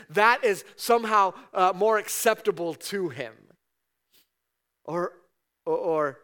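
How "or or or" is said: normal talking voice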